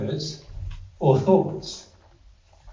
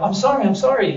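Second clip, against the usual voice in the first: high, louder mock pleading; 9 semitones higher, 5 dB louder, faster than usual. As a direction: beg forgiveness as if dodging a punch. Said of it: "like pleading with you".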